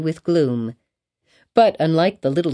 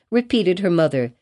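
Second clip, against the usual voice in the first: plain reading voice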